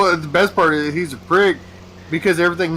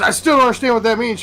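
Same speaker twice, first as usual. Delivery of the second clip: higher and fast, a rushed puzzled woman; confused woman; 10 semitones higher, 3 dB louder, faster than usual. Retold or imitated. Woman still not getting it, higher and quick. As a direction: rattle it off, pitched up and lost. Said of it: "She goes".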